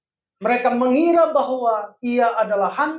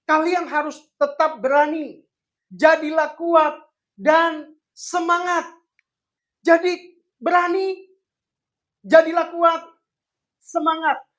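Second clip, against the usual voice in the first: rousing exhortation; 5 semitones higher, slower than usual. Real, not acted. Preacher urges courage.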